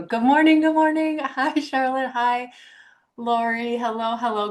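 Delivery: good morning sung out high and lively; bright greeting. Real, not acted. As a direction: greet each arrival warmly and eagerly.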